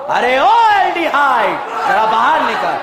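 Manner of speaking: drawn-out menacing call, laughing; bully calling someone out of hiding; threatening call. Acted. Teacher voicing amine bullying ketone, menacing call. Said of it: "धमकी दी और बोला".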